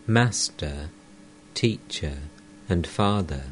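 plain reading voice

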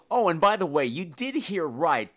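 normal talking voice

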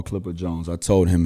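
usual speaking voice

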